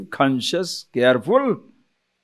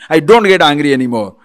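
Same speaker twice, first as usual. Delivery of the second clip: loud, heated insistence; hammed-up shout that denies any anger; angry denial; 8 dB louder than usual. Person denying anger to a doubter, loudly angry.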